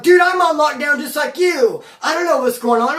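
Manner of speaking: high, whiny mock innocence; victim stance. Cellmate's kite voiced as a whiny, high victim. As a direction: play the wounded innocent. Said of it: "his kite, bro, was victim stance".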